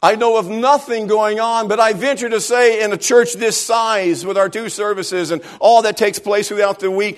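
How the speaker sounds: high, loud pulpit preaching; full-force preaching. Acted. Pastor preaching hard to his church about loving people. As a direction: drive it home to the congregation.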